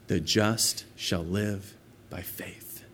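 slow, hushed whisper of scripture. Acted. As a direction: breathe the verse like a revelation. Reading the verse in awe.

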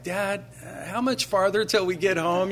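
lightly voiced weary boy asking to go home; tired son. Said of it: "he's like".

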